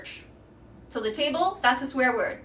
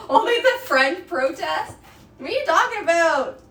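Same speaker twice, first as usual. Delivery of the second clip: higher, louder mock disbelief; mock outrage; 4 semitones higher, 5 dB louder than usual. Streamer feigning offense at chat's French jibe. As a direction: play-act being offended at the joke.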